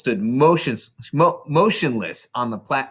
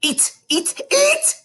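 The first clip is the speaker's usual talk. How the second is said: escalating shrill command; yelling; slower than usual. A narrator's reading. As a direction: bark the order to eat. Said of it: "the Trunchbull was yelling".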